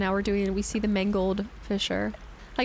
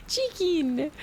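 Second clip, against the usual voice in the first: said through a laugh, much higher; amused laugh-word; 9 semitones higher than usual. Real, not acted. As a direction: giggle out chicken. Laughs at viewer name.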